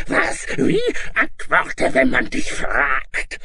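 high, loud screeching monster rage; harsh, shrill dragon tirade; furious dragon shouting. A narrator's reading. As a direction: shriek at the child like an enraged dragon. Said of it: "knuffte sie und schrie".

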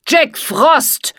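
name shouted loud and high, Jack hit hard; furious self-proclaiming yell; angry shout. Acted. Villain shouts own name.

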